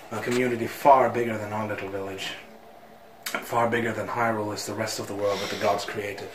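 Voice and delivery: low, soft storytelling villager; voice-acted game character. Villager telling Link about Hyrule, low solemn voice acting. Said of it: "I'm gonna do voice acting".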